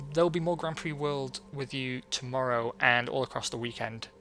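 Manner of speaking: ordinary talk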